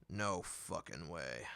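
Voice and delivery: low, slow, final refusal; stern father. Acted. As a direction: lay down the law like a gruff father. Narrator echoes father's rule.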